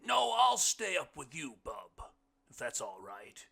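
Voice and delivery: big, high voice sinking at phrase ends; loud, folksy character voice; hearty blind man. A narrator's reading. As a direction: project it high and loud, like a booming guest. Blind man's speech in story.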